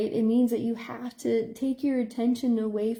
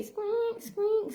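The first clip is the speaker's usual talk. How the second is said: high squeaky hinge noise; door squeak imitation; 12 semitones higher than usual.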